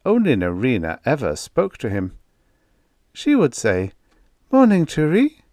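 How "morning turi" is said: bright, high, lively maid's hello; cheery maid greeting. A narrator's reading. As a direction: call out a chirpy good morning.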